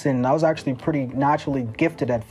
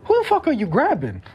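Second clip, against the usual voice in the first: high-pitched, indignant disbelief; retold startled challenge, pitched up; mock-offended, voice jumping higher; indignant retort; 9 semitones higher than usual. Retells confronting a stranger grabbing him.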